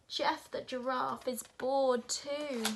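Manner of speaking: put-on a child's play voice for her giraffe; toy giraffe voice.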